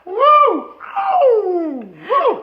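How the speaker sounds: high, loud woo; excited whoop. Real, not acted. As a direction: cheer it out. Demo then whooping.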